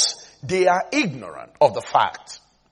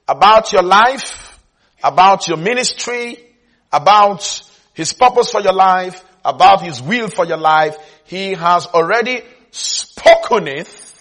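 loud, building list with repeated attack; forceful preaching; 3 semitones higher, 6 dB louder than usual. Real, not acted. Preacher telling believers what the verse means, forceful. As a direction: hammer each "about" toward the conclusion.

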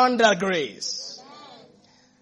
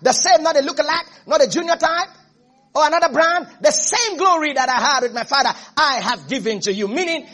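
raised, insistent sermon delivery; fervent preaching; 6 semitones higher, 4 dB louder than usual. Acted. Preacher telling the church they share the same glory, fervent. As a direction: drive each phrase up to a proclamation.

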